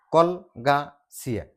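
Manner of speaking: কল, গা, চিয়া as three distinct beats; separated syllables. Shown as a demonstration. Teacher showing the word has three syllables, separated. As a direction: count out কল, গা, চিয়া with a pause between each. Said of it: "চিলেবুলৰ সংখ্যা হ'ব".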